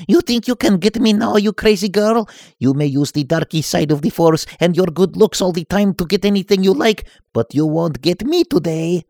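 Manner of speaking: cocky nerd. Acted. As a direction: taunt the dark lady boldly. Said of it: "Vasily continued".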